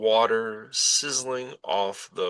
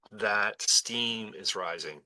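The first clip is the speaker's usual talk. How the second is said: ordinary talk, nothing marked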